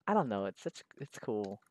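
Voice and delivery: normal talking voice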